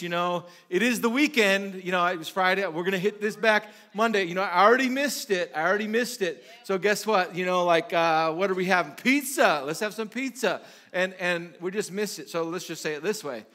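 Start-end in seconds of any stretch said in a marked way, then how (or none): 0.0-10.6 loud, raised, carefree excuses; mock-enthusiastic rationalizing; hammed-up self-justifying. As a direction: talk yourself into the binge with gusto. Acts out excusing weekend bingeing.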